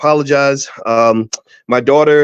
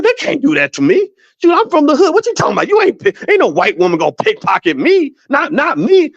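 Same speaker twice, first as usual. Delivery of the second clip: high-pitched, cocky protest; mock indignant disbelief; 12 semitones higher than usual. Retelling his protest to the lady who warned him, mock indignation. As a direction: act out refusing to believe it.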